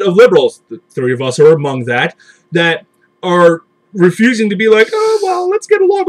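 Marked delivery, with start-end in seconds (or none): 4.9-6.0 high sing-song pleading voice; mock meek liberal. Mocking liberals begging conservatives to get along, prissy falsetto.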